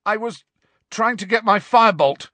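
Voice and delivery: croaked excuse. Acted. Harry excuses himself while held by hair, croaking. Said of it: "Harry croaked".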